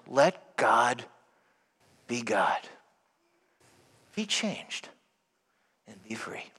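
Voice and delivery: low, soft closing blessing; hushed preaching. Acted. Priest softly blessing the congregation at the end. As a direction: bless them gently and softly.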